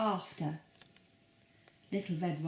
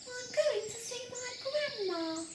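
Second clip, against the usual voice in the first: put-on much higher, sweet little girl; 4 dB quieter than usual. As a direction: squeak it like a tiny girl. Girl voiced in the story.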